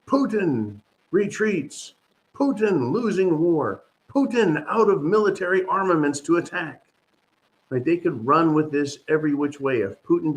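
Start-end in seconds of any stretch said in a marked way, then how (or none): 0.1-6.8 mock headlines. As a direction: read them out like a sneering headline crawl. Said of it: "the mainstream media will go off the hook".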